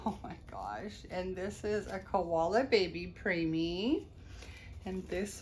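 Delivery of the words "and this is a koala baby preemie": ordinary talk, nothing marked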